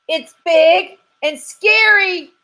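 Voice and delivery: high, loud squirrel voice, dragging out scary; a jittery squirrel spooked to the bone; scared squirrel. Acted. Squirrel telling the detective about the monster, high and loud. Squirrel repeats his description.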